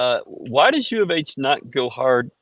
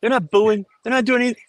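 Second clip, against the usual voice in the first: normal talking voice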